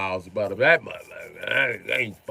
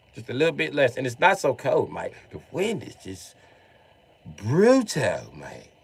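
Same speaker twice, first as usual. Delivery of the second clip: imitated London bloke; London accent. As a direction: play a London guy chatting to his mate. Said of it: "guys from London have told me".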